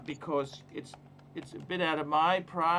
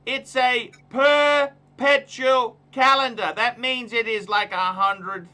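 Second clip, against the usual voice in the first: higher, louder, slow and deliberate; deliberate raised voice; slow loud emphasis; 6 semitones higher, 9 dB louder, slower than usual. Real, not acted. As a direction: spell it out for him loudly. Emphatic insistence.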